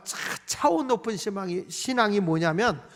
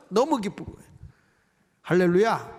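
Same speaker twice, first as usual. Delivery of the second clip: ordinary delivery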